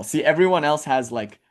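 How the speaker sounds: ordinary talk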